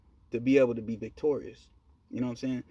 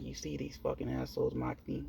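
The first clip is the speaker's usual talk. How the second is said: quiet aside to doll; 8 dB quieter than usual. Retold or imitated. Talking quietly to his doll.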